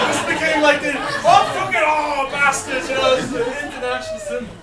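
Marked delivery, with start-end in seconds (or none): 1.0-2.9 high loud oh then slowed bastards; mock exasperated fan yell; mock fan outburst. Mimicking Irish fans' reaction.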